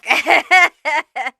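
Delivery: a sudden high, loud laugh; loud burst of laughter. Real, not acted. A reader cracking up at her own red-flag line, laughing loudly.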